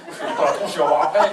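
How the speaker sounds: ordinary delivery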